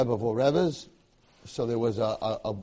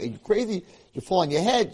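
higher, louder mock disbelief; raised, scandalized retort; incredulous friends; 8 semitones higher, 6 dB louder than usual. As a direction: imitate friends exclaiming in alarm. Friends react, retold as quarrel.

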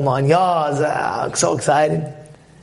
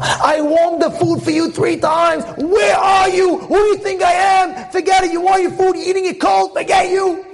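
wife bugging out; 13 semitones higher than usual. Acted. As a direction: scream it like a furious wife. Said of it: "The wife could bug out".

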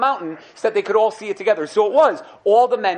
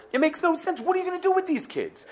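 incredulous; 11 semitones higher, 3 dB quieter than usual. Retold or imitated. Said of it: "says the Ramban".

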